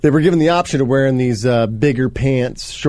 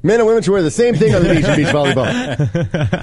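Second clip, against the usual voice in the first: high mock voice; 8 semitones higher than usual. Mock outraged beach rule.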